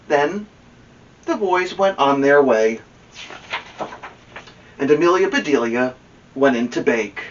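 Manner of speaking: ordinary delivery, nothing marked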